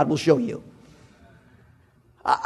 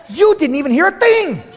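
very high, exasperated mock yell; comic squealing indignation; incredulous outburst; 11 semitones higher, 5 dB louder than usual. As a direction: protest in hammed-up disbelief. Retold incredulous outburst at kids.

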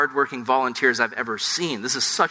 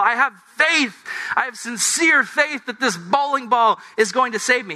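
desperate mock plea; 8 semitones higher than usual. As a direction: beg for the bowling ball, hammed-up desperation. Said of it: "And you go".

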